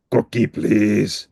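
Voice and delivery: slow, drawn-out monster voice; Cookie Monster. Acted. Cookie Monster politely asks Bert for a cookie, slow monster voice. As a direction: savour the word like a hungry monster. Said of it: "said Cookie Monster".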